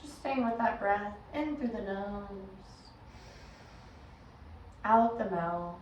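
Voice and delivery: measured meditation guide, long pauses between phrases; guided meditation. Acted. Yoga teacher guiding listeners into breath and body, guided meditation. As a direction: lead them inward unhurriedly, letting each instruction settle.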